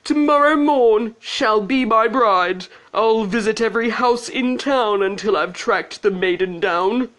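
high, declamatory announcement; prince crying out. A narrator's reading. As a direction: declare it grandly as a princely vow. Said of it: "he cried".

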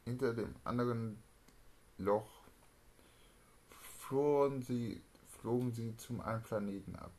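ordinary talk

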